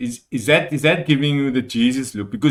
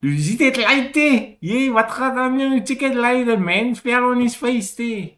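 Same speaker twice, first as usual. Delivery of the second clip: Cape Coloured accent; 12 semitones higher, 4 dB louder than usual. Shown as a demonstration. Copying his friend's demo, high Cape Coloured accent. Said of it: "Yeah, like that".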